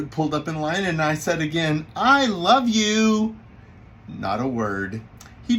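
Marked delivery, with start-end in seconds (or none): 1.9-3.3 slow, loud, high drawn-out declaration; louder, sing-out insistence; emphatic repeat. Retold or imitated. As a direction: stretch it out so he can't miss it.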